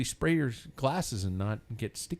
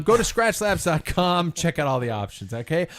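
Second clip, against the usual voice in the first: louder, upbeat sponsor plug; ad read; 5 semitones higher, 8 dB louder than usual. Acted. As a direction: sell it like a podcast ad spot.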